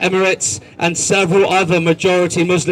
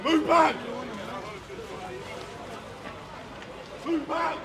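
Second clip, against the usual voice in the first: riot police shouting orders; 11 semitones higher, 9 dB quieter than usual. Real, not acted. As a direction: order the crowd back, shouting. Police pushing back a crowd.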